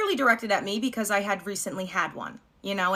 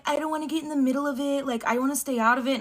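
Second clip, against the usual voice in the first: lightly voiced boyfriend, pitched higher; reluctant boyfriend; 5 semitones higher than usual. Boyfriend staying out of his girlfriend's argument, lightly mimicked. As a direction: imitate the boyfriend backing away, a higher voice. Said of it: "Boyfriend's like".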